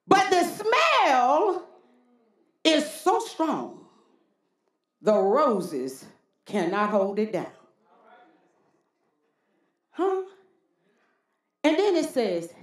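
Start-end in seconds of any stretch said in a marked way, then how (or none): none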